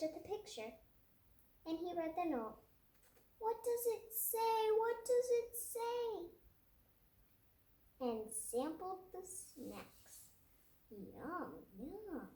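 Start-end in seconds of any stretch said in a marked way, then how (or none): 3.4-6.3 a high, squeaky little voice asking twice; high squeaky character voice. Acted. 10.9-12.3 quiet delighted yum. Acted. Savoring snacks quietly.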